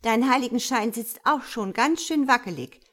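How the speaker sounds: irritated, pointed comeback; spiteful snarl. Acted. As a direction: snap a needling warning. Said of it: "faucht Zachariella".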